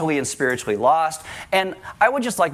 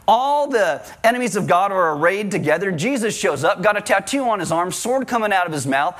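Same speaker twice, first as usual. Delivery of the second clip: ordinary talk, nothing marked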